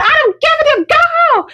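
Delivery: very high, re-enacted yell; retold yelling. Yelling down at the man who scared them, retold high-pitched yell. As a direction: cut him off with a high mock yell. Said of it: "that's all I'm yelling".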